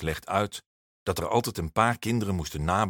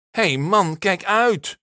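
high, loud warning yell; angry boy's shout; bellowing; 11 semitones higher, 6 dB louder, slower than usual. A narrator's reading. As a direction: roar at him to watch out. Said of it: "brult Halil".

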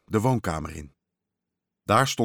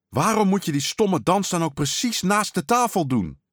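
high, exasperated scolding; acted boyish fury at a sibling; angry outburst; 9 semitones higher, 3 dB louder than usual. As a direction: snap at your little brother, pitched way up. Boy angrily scolds his brother.

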